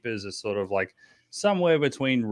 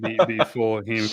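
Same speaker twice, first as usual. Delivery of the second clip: normal talking voice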